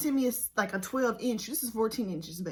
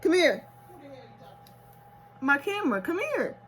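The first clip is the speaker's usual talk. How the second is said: loud higher call; louder and higher, calling; 6 semitones higher, 7 dB louder than usual. Real, not acted. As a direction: call someone over. Calling to someone.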